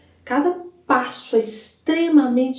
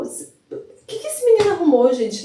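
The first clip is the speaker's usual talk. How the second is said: riso espantado; 7 semitones higher than usual. Real, not acted. Espanto rindo do personagem.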